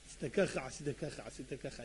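low murmur, nearly whispered; very soft, low repeated accusations; hushed listing. Acted. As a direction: reel off secrets under the breath. Rabbi privately listing someone's sins.

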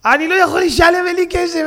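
pleading beggar. Retold or imitated. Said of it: "הוא בוכה לנהג".

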